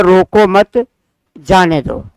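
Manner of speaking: "रोको मत" as one group, short break, "जाने दो" falling; brief stop after मत, drop on जाने दो; pause after मत. Shown as a demonstration. Teacher reading out the example sentence, pause after मत. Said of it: "यहाँ ठहराव दिया गया है".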